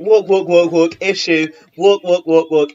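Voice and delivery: high, chanted mock beat, walk then a stiff stop; sing-song mockery of a clunky script; mimicking a choppy storyline, chant-like; mocking rhythm. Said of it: "they'd be like".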